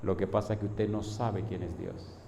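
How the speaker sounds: low, soft, unruffled answer; calm reply. Retold or imitated. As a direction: answer an angry man quietly. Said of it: "con la mirada tranquila y le digo".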